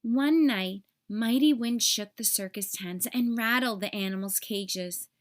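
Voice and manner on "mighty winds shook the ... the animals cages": usual speaking voice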